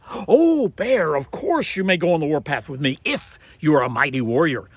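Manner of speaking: loud flattering reply; butter him up loudly; flattering call. Acted. Turtle flatters the bear, loud coaxing voice.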